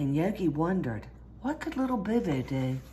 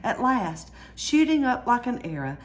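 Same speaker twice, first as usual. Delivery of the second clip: plain reading voice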